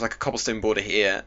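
ordinary talk

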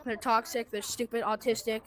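ordinary delivery

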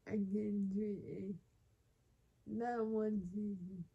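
burped letters, loud pulses; burping the words. Real, not acted. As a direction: talk through a burp.

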